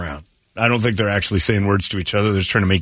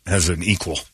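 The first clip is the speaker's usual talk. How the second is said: normal talking voice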